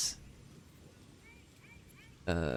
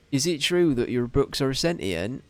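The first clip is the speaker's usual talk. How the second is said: put-on over-the-top West Country accent; 4 semitones higher, 4 dB louder than usual. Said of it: "Ooh, I went proper".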